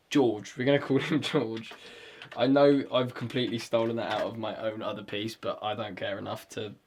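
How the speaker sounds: usual speaking voice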